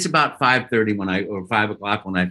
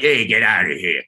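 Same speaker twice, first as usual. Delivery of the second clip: lower, grumpy Brooklyn plumber voice; negative Brooklyn guy; 5 semitones lower than usual. Acted. Actor showing what would scare kids, negative Brooklyn gruffness. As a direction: play a mock-hostile plumber shooing people off. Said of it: "that negative sort of like".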